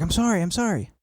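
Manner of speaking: high-pitched imitated pleading; high mock apology. Wife apologizing to flustered husband, high mock voice.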